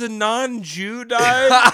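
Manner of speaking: high, loud, slow mock pity; mock sad audience. Friend mocking moviegoers' grief, loud mock wail. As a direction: wail it in exaggerated fake sorrow. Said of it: "We're like, "Aw".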